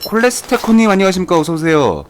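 high, loud formal welcome; excited greeting. Real, not acted. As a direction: welcome them with gusto. Streamer greeting a viewer.

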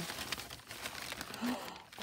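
sharp breathless intake of breath; surprised gasp. Real, not acted. Unwrapping Geeta's parcel, a sudden breathy gasp. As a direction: gasp as the gift is revealed.

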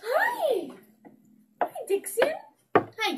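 high, bright greeting with a laugh; squeaky pet greeting. Real, not acted. Owner greets her dog with a high squeal.

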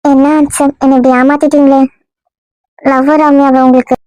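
a lightly put-on girl's voice, hurt and coy; woman's voice. Disguised man plays the girl wooed by the husband. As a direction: play the wronged young woman, only lightly feminine. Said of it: "இன்னும் பொம்பளை குரல்ல தான் பேசுற".

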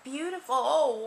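ordinary talk

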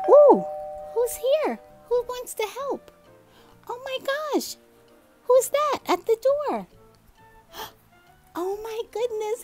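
high, excited gasping surprise; surprised excitement. Acted. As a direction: act thrilled someone's at the door. Visitor arrives at the door.

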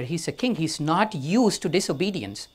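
ordinary delivery

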